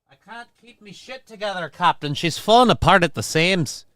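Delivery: a bad Scottish accent like the engineer reporting to his Captain; mock Scottish engineer accent. Streamer jokes to the Captain in a hammed-up Scottish accent. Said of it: "Just like this accent".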